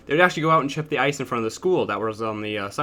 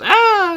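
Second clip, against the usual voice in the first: sudden high yelp, loud; startled yelp; 9 dB louder than usual. Retold or imitated. Kid yelping as he gets tripped on the field. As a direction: let out a sharp high cry. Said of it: "and he goes".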